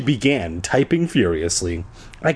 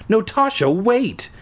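higher, urgent plea; pleading; 10 semitones higher than usual. A narrator's reading. Boy pleading with Tasha not to kick him out, desperate. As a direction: beg her to stop. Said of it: "I pleaded".

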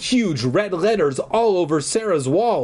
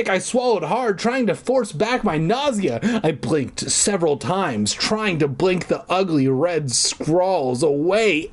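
ordinary delivery

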